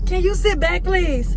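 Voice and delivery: playful sassy request; bossy aside. Real, not acted. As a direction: shoo him off with sass.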